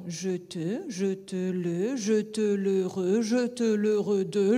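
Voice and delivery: slow scansion, «je te le re» built up a syllable at a time. Shown as a demonstration. Teacher scanning the rhythm for students. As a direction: tap out each syllable slowly. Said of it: "sur la scansion".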